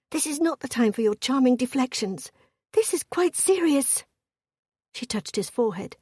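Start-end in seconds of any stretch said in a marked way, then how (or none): 0.1-4.0 higher-pitched woman's reproach; earnest, rising rebuke; urgent scolding. A narrator's reading. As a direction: scold him for joking, pitch climbing. Wife scolds husband for joking.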